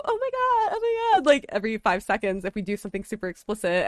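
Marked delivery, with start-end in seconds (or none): 0.0-1.3 squealing high "oh my God"; excited friend. Retold or imitated. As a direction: gush like an overexcited friend. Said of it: "and you're gonna go".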